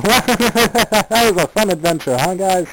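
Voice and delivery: high, loud cartoonish character voice; hammed-up high voice, stretched out; Auntie out of costume. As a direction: pipe it out high and merry, at full volume. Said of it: "He stepped out of it and said".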